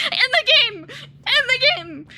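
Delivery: high, loud, hurried disclaimer; urgent clarifying shout. Real, not acted. Streamer clarifying a violent joke to viewers, urgently calling out. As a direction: blurt it out loudly to correct yourself.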